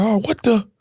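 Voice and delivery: high, startled cry cut short; a man caught off guard, jolted; startled victim. Retold or imitated. Robbery victim jolted by a sudden hit, startled high cry. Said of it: "And he's like".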